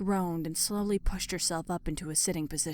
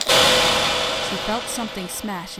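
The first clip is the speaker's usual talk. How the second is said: loud; 8 dB louder, slower than usual. A narrator's reading. Narrating sudden blow.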